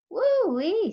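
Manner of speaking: high, loud whoop; delighted exclaim. A narrator's reading. Old woman marveling at the downpour, whooping. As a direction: whoop at the storm. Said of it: "said Gloria".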